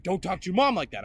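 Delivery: retold angry scolding; scolding stepdad. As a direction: imitate a stepfather telling a kid off.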